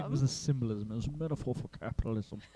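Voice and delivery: mock posh accent, a bit quick; hammed-up snooty accent; pretentious accent. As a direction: put on a vague pretentious accent. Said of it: "especially like the accent".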